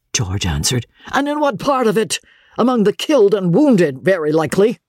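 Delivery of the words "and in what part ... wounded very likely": high-pitched bitter sarcasm; indignant and sardonic; scornful retort. A narrator's reading. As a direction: scoff at the idea, pitched up. Angry George scoffs at friend's advice.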